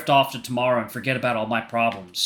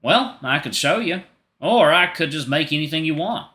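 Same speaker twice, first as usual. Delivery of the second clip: higher, louder country twang; country accent; 4 semitones higher, 4 dB louder than usual. Acted. Reader voicing David offering to make things, country accent. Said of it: "David's getting a country accent, y'all".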